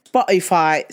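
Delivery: usual speaking voice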